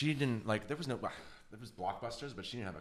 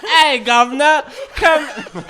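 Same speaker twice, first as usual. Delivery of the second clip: hammed-up Cockney-style call, much higher, louder, slow; mock British accent; 19 dB louder, much slower than usual. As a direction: laugh through a hammy British accent, drawn out. Acting out a Monty Python line.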